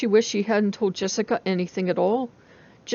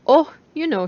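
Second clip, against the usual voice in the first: casual; 7 semitones higher, 6 dB louder than usual. A narrator's reading. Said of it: "she said casually".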